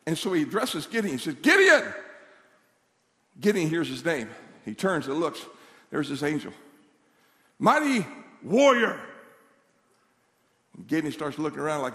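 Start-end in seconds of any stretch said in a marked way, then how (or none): 1.4-1.9 loud, high call of a name. Acted. Angel hailing Gideon by name, calling out. 7.6-9.0 slow, drawn-out heroic address; grand hailing. Acted. Angel addressing Gideon as warrior, grand hailing.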